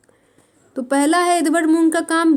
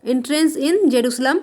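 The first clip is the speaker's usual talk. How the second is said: slow dictation; much slower than usual. Shown as a demonstration. Teacher dictating English title.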